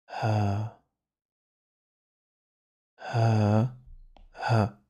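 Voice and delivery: "Ha" repeated as a breathy throat h before a; Arabic ha sound. Shown as a demonstration. Teacher to pupils, drilling the sound, breathy "Ha". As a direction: model "Ha" slowly for pupils to copy. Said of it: "c'est le ha".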